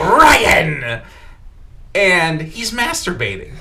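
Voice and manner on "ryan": sudden shout of the name; loud, drawn-out startling yell; yelling to startle. Retold or imitated. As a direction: burst in yelling his name to scare him. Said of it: "and I go".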